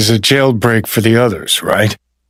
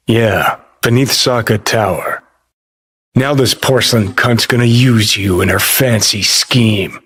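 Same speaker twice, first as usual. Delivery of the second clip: ordinary talk, nothing marked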